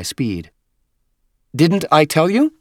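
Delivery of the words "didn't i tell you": high, excited character voice; exclaiming Huragok. A narrator's reading. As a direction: exclaim it brightly as a rising question. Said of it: "the Huragok exclaimed".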